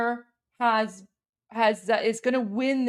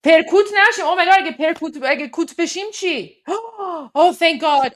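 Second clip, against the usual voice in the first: excited relief; 4 semitones higher, 6 dB louder than usual. Real, not acted. Relieved by the card drawn.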